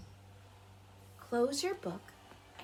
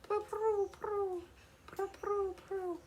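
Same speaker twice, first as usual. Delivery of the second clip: pigeon calls; 10 semitones higher, slower than usual. Retold or imitated. Copying the pigeons outside her house, high coo. Do you hear those pigeons?.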